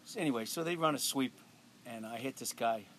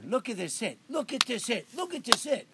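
rising, louder each time; coach hyping the hit; 10 semitones higher, 4 dB louder than usual. Retold or imitated. Coach replaying the hit on film, building excitement. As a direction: coach getting more excited with every replay. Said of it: "played that play over and over".

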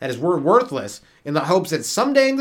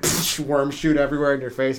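ordinary talk, nothing marked

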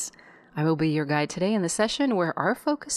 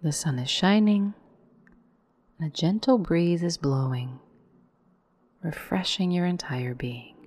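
guided meditation. Acted. Guide leading listeners into yoga nidra, calm meditation voice. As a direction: lead the breath in on a rise, let the exhale fall away.

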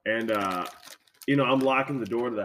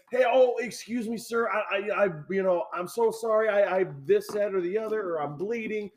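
high, flustered stammering apology; hammed-up timid stranger asking help; imagined polite plea; 7 semitones higher than usual. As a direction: plead apologetically, pitched well up. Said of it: "And you don't say".

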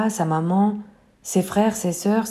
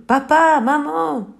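appel aigu et fort de détresse; hurlement; 7 semitones higher, 4 dB louder, slower than usual. Acted. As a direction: crier papa maman, perdue en mer. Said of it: "la petite poule hurle". (petite poule perdue qui crie ses parents, aiguë et forte)

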